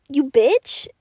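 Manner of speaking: mildly hammed-up outrage; retold angry comeback. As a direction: throw the insult with mock venom. Acted comeback insult to bully.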